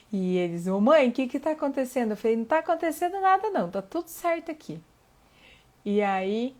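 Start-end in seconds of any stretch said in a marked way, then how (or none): 0.7-2.1 put-on kids calling. Kids calling through bathroom door. 2.4-4.8 innocent cover-up. Retold or imitated. Mother answering kids after yelling.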